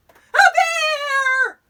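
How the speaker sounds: very high, loud, drawn-out shriek; scream. A narrator's reading. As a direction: scream in fright at the sight. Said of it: "screamed Eddie".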